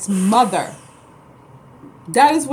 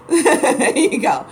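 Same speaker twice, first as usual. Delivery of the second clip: slow, loud laugh through the words; 5 semitones higher, 5 dB louder, much slower than usual. Real, not acted. Pleased with her Babylon point, laughing it out. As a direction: laugh out the punchline.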